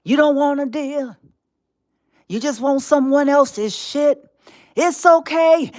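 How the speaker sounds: normal talking voice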